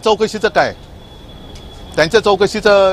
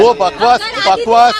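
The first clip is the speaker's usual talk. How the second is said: higher, sharp "बकवास" tossed away; curt Hindi brush-off of a reporter's question; scornful dismissal; 13 semitones higher than usual. Real, not acted. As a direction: wave the accusation off as rubbish. Brushing off a rival's charge.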